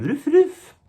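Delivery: high, loud mock bark; French dog bark. Comic imagines a French dog barking, high yelp. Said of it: "a dog in France going".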